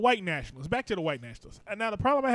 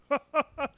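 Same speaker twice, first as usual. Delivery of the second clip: exaggerated, rhythmic ha-ha-ha; mock laugh; 8 semitones higher than usual. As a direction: put on a higher, hammed-up chuckle.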